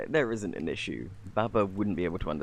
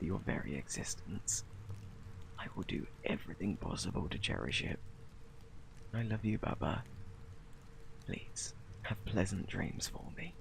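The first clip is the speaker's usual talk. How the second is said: soft, hushed and loving; tender whisper; 2 semitones lower, 10 dB quieter than usual. Real, not acted. Parent wishing sleeping baby sweet dreams, tender whisper. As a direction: say goodnight as gently as possible.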